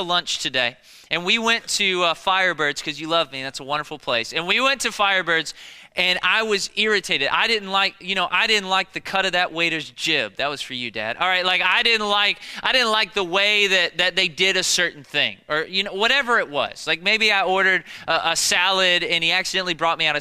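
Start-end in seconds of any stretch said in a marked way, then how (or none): none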